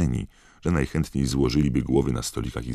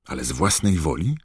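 eager, slightly higher query; lively question; 4 semitones higher than usual. A narrator's reading. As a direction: ask with keen interest. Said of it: "zapytała żywo Hermiona".

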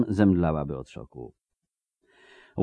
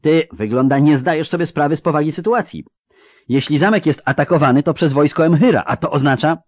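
higher, louder, urgent voice; agitated warning figure; 8 semitones higher, 6 dB louder than usual. Acted. Warning the wizard the castle is attacked, agitated. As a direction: press the danger home, raised and insistent.

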